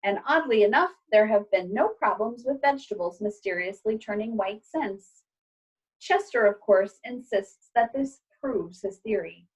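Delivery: ordinary delivery, nothing marked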